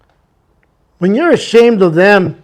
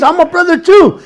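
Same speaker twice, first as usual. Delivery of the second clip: higher, joyful exclamation; excited; 8 semitones higher than usual. Retold or imitated. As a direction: proudly announce you're one too. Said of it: "He was excited".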